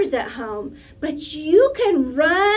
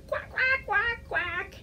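high, quiet puppet quacking; duck quacks; 4 semitones higher, 9 dB quieter than usual. Pretend. Puppet duck agrees to being set down, quiet high quacks. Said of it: "Is that okay?".

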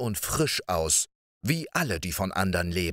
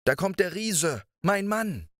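frightened cry; 9 semitones higher than usual. Acted. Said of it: "rief die Frau erschrocken".

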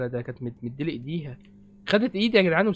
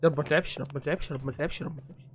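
fading prayer; 3 semitones lower, 6 dB quieter, faster than usual. Real, not acted. Praying nothing plays.

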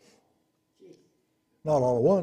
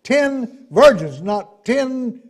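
high, loud pulpit cry; forceful preaching; 10 semitones higher, 10 dB louder, slower than usual. Real, not acted. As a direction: hammer it home to the congregation.

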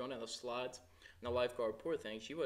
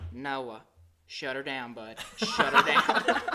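mock dad, drawn out and louder at the end; imitating dad; 12 dB louder, much slower than usual. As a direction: do the tired dad telling them to shut it down. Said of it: "he says".

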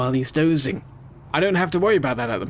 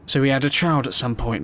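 ordinary talk, nothing marked

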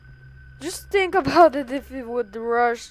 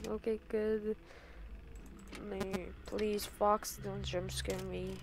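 hushed, nervous pleading; scared horror game streamer; 3 semitones lower, 13 dB quieter than usual. Real, not acted. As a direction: whisper it, bracing for a scare. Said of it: "I'm so scared of him".

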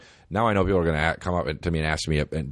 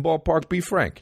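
New York guy; 10 semitones higher, slower than usual. Retold or imitated. Comic New York hot dog vendor.

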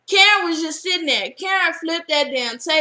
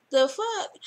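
laughing disbelief; 5 semitones higher, 7 dB quieter than usual. Retold or imitated. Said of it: "And Renee's like".

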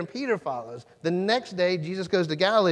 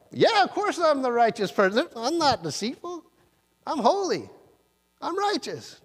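much higher, smug voice of self-righteous pride; prideful Nathanael; 10 semitones higher than usual. Retold or imitated. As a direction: boast like a man sure of his own holiness. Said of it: "a little bit of pride in Nathanael's response".

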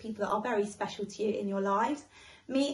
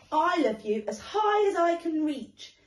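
higher, louder eager boast; little hare bragging sweetly; 7 semitones higher, 5 dB louder than usual. Acted. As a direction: reach for it with the voice.

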